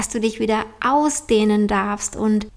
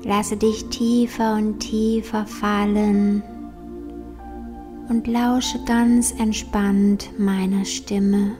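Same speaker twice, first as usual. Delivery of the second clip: slow, soft meditation voice; guided meditation; 5 dB quieter, slower than usual. Acted. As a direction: guide them gently into calm, slowly.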